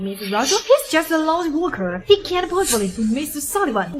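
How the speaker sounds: ordinary delivery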